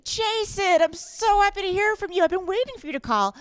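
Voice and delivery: high-pitched overeager fake enthusiasm; mock gushing. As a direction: gush at the robot like a fan. Mock eager answer to robocall.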